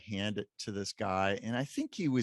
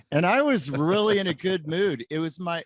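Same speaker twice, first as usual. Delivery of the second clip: ordinary talk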